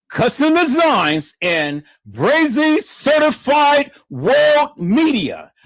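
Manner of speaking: slow, loud announcer call; drawn-out shout. Real, not acted. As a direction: stretch out the names and shout them. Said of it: "hyping up the names".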